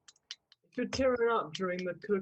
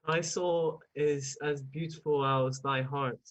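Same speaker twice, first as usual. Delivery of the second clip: slow, low, solemn recital; measured theatrical declaiming; Shakespearean; 6 semitones lower, slower than usual. Pretend. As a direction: woo in mock stage verse.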